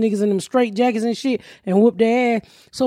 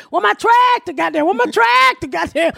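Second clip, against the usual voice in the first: tractor man; 8 semitones higher than usual. Retold or imitated. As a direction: belt it out way up high like the man hunting for his tractor. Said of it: "But he be saying".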